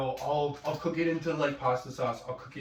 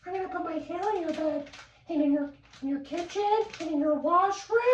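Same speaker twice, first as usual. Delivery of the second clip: much higher, fast creepy witch voice; cackling witch taunting her victim; a hag threatening to leave her hair everywhere; 13 semitones higher, faster than usual. Retold or imitated. Imitating creepy witch woman.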